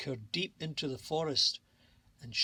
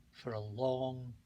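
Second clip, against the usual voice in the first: slow, fading softer to the end; soft storybook ending; 3 semitones lower, 4 dB quieter, slower than usual. A narrator's reading. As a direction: let the last words drift down gently. Fairy tale closing line.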